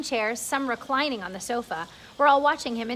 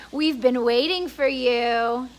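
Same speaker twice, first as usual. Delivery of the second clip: second voice; 5 dB louder, slower than usual. Acted. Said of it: "another one said".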